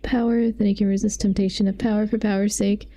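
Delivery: ordinary talk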